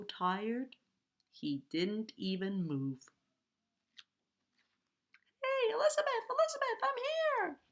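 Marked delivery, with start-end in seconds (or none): 5.4-7.6 very high, squeaky cry for attention; high-pitched storybook voice calling from afar; prince calling out. A narrator's reading. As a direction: call urgently in a tiny high voice. Trapped prince calls for rescue.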